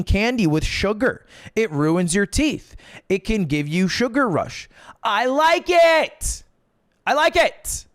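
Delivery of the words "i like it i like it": high, drawn-out insistent cry; defiant outburst. Real, not acted. Defiant outburst defending his candy pick.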